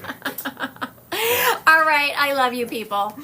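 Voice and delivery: high, loud burst of laughing; laugh, then speak up through it; real laughter. Real, not acted. Laughing at a chat reply.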